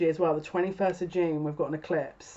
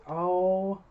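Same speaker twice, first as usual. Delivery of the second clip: long, drawn-out stunned oh; mind blown. Real, not acted. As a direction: let the oh hang, stunned. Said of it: "this has already blown my mind".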